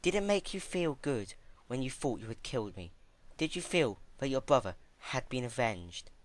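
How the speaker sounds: a hint of a serene, probing ruler; gently questioning royal mentor; calm princess. A narrator's reading. Celestia's voice in narration.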